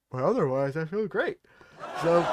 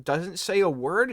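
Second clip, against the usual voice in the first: ordinary talk, nothing marked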